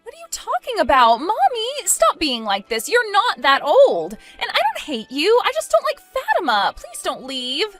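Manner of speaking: high-pitched, agitated pleading; exasperated protest. Acted. Bride talks mommy out of feeling old, exasperated protest. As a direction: argue back urgently, pitch jumping high. Daughter protests mother's guilt trip.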